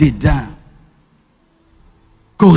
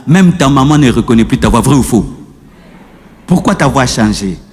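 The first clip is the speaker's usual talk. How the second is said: usual speaking voice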